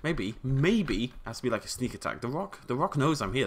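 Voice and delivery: ordinary talk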